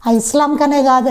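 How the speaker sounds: usual speaking voice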